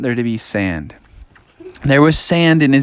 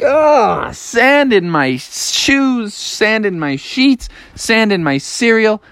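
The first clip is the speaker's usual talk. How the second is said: slow, higher-pitched exasperated list; 5 semitones higher, slower than usual. Acted. Froggy moaning about sand everywhere, slowly exasperated. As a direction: draw out each sand complaint.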